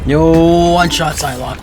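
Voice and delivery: loud hype yell; excited shout. Real, not acted. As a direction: shout it, thrilled. Hype over a kill.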